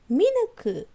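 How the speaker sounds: high, surprised "Minäkö?"; surprised question. A narrator's reading. Vivi asking the mirror aloud, surprised. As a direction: ask it in disbelief. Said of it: "kysyi Vivi ääneen".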